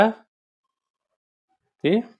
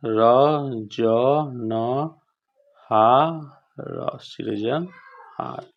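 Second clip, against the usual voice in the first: spelled out slowly; 5 dB quieter, much slower than usual. Shown as a demonstration. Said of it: "सहर्षिकार".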